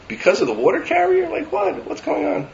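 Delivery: put-on a young bride, baffled and pleading; incredulous bride. Bride questioning her future father-in-law, high and incredulous. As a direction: pitch jumping up high in disbelief. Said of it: "the Kala turned to her illustrious father-in-law to be and said".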